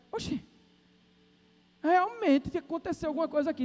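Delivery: high-pitched surprise, acted out; astonished doctor. Doctor examining the healed hand, acted high-pitched astonishment. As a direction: start high in disbelief.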